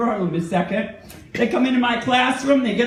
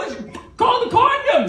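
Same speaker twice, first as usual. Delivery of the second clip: mock revelation; 11 semitones higher than usual. Punchline word.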